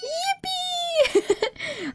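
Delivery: high shrieking laugh of glee; squealing laugh. Real, not acted. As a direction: shriek with delight. Celebrating the vote.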